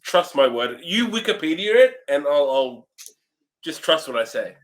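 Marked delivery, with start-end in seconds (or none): none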